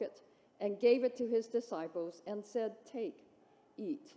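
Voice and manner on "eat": usual speaking voice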